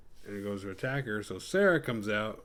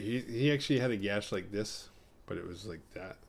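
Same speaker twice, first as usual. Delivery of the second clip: ordinary talk, nothing marked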